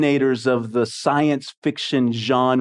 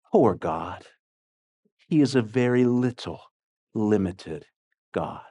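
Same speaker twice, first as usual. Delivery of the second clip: sad; 6 semitones lower, 4 dB quieter than usual. Retold or imitated. Reader quoting Wells on a helpless God, sad. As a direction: let it sink lower and softer, with pity. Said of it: "listen how sad this is".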